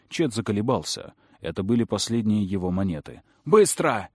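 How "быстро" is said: loud sharp snap of a command; sharp shout. Acted. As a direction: bark the order at him. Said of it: "прикрикнула Мэри".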